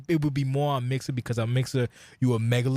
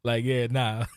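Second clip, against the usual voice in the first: ordinary talk, nothing marked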